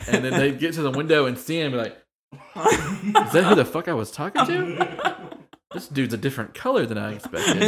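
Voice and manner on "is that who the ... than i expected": mock shock, laughing. Customers shocked at window.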